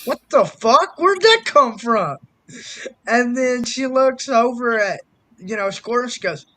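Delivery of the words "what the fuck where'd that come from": loud, high-pitched bewilderment; hammed-up, incredulous questions; retold disbelief. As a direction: re-enact being floored by it. Retold reaction to odd nickname.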